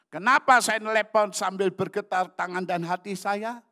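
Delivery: high, very loud preaching question; shouted rhetorical question. Real, not acted. Preacher asking congregation why he trembled on the phone, high shout. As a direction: throw the question out to the whole congregation.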